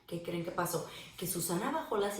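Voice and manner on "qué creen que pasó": ordinary delivery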